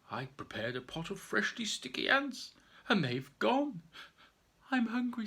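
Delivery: a hungry bear complaining; sad bear voice. Acted. Bears lament.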